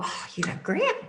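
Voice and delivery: high, soft gushing voice; gushing compliment. Retold or imitated. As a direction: coo it up high. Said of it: "me, like, go".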